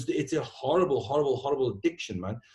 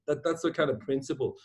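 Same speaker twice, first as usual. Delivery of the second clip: ordinary talk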